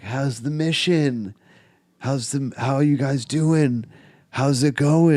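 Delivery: put-on older guys ribbing high teens, teasing; teasing mock greeting. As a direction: needle the tripping kids with fake friendliness.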